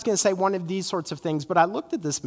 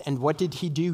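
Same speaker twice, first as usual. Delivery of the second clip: ordinary talk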